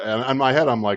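normal talking voice

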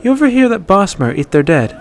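playful female voice; gossipy Ayala. A narrator's reading. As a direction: lean in with juicy gossip.

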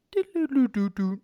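light mock chime; imitated chime tune. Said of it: "I hear that".

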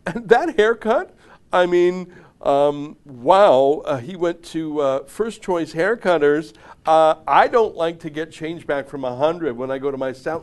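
exaggerated, affected disdain; mock snob. Snob sneering at another man's haircut, mock disdain. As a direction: sniff at it like a hammed-up snob. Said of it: "it's pure snobbery is what I'm saying".

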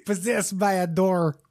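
put-on slow, hammy Russian accent; mock Russian accent. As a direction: confess it slowly in a fake Russian accent. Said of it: "I have the Russian accent".

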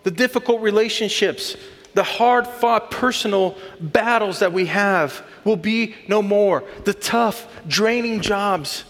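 preacher swelling toward the promise; rising sermon fervor. Acted. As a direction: lift the congregation with mounting hope.

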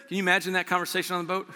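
usual speaking voice

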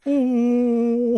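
high, laughing wobbly Piggy whine; Frank Oz Emo mix. Retold or imitated. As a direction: fret like a lost diva. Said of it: "it would sound a little something like this".

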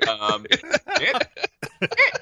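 high, playful coaxing of a dog; wheedling dog-trainer voice; coaxing a pet. Pretend. Teaching the dog to swear.

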